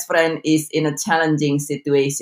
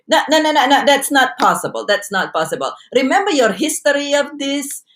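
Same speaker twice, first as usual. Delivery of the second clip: dismissive inner voice; 7 semitones higher than usual. Retold or imitated. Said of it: "many times we say".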